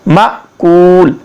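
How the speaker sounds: माकूल very slowly, glottal catch after "ma"; hamza pronunciation. Shown as a demonstration. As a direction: cut ma short, hold the kool in माकूल. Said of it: "तो ये हमज़ा है".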